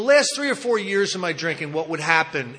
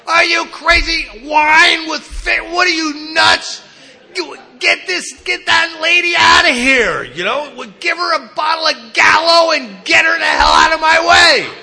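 much louder, higher exasperated yell; furious shout; 9 semitones higher, 9 dB louder than usual. Retold or imitated. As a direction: blow up at the lady holding up the line. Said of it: "I'm like".

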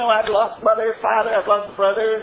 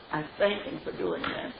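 much softer, almost a murmur; near whisper; 4 semitones lower, 11 dB quieter than usual. Real, not acted. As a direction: let it fade to a hush of thanks. Repeated, even softer.

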